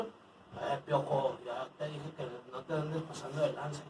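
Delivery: low, soft menacing thug; tough guy. Retold or imitated. Man imitating the tough ex-brother-in-law threatening him, low.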